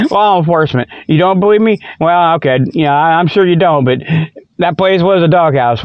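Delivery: usual speaking voice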